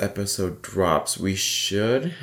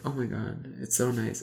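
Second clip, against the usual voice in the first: hushed delight; 4 semitones higher, 9 dB quieter than usual. Retold or imitated. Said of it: "I was like".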